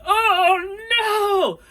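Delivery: very high, loud drawn-out wail; mock horror. Friends watch roommate shaking on the ground, mock-horrified wail. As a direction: wail it in hammed-up dismay.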